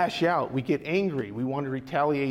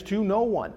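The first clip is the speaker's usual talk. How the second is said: "no one" set apart and raised; stress on NO ONE; 4 semitones higher than usual. Shown as a demonstration. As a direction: lean firmly on "no one". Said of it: "The emphasis is on".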